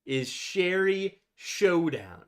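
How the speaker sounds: slow, high, drawn-out title call; announcer voice. Acted. Drawn out announcer naming episode.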